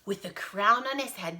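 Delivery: ordinary delivery, nothing marked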